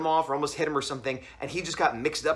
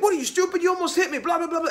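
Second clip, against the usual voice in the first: high-pitched imitation of a ranting driver; mock angry rant; 13 semitones higher than usual. Driver accusing narrator of nearly hitting him, mock irate. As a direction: rant in a raised mock voice, trailing into blather.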